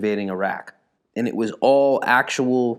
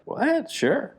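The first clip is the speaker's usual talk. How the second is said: high, soft-edged little reaction; pitched-up mild surprise; mock surprised; 13 semitones higher, 4 dB quieter than usual. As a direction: retell a small startled agreement. Retold reply to manager.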